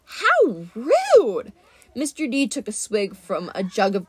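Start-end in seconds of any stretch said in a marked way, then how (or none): 0.0-1.5 high, loud mock offence; prissy outrage at being shouted at; high, loud indignant god; affronted huff. Mister D offended.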